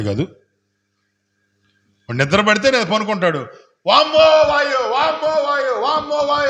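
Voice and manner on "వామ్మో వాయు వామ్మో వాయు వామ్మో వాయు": high mock moaning of a man in pain; groaning patient. Pastor imitating a suffering patient, high mock moaning. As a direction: wail like a sick man in a hospital bed. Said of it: "అంటా ఉంటా ఉంటాడు".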